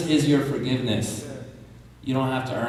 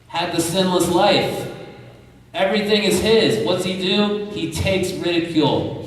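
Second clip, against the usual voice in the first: impassioned preaching; 6 semitones higher than usual. Acted. Preacher describes the crucifixion.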